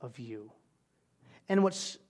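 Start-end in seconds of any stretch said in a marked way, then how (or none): 0.0-0.5 soft, slow, lower reassurance; hushed and tender, slow; gentle voice of God. Acted. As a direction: speak it like a tender promise. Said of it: "He said".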